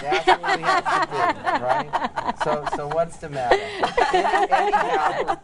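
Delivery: real laughter breaking up the joke; laughing. Real, not acted.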